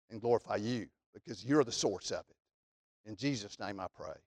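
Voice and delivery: hushed, low, reverent prayer voice; closing prayer. Acted. Preacher praying quietly for his congregation, hushed and reverent. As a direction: pray it softly, with humble reverence.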